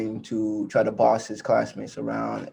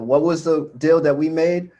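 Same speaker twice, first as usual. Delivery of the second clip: higher, raised classroom voice, lightly imitated; teacher addressing class; 7 semitones higher than usual. Teacher asks the class about the deal, retold in a raised teacher voice. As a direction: recite the class rule like a teacher.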